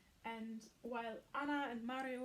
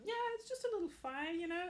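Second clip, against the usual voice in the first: quick, higher, carefree brushing off; breezy excited; 5 semitones higher, 4 dB louder, faster than usual. Retold or imitated. Nick brushing off Noah's worry, fast and carefree. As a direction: toss it off lightly, fast and bright. Nick defends fighting to Noah.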